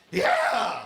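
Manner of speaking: sudden high, loud yelp of triumph; hammed-up victory yell, way up high; Howard Dean scream. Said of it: "he went on T V and he was like".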